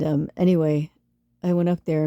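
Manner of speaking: usual speaking voice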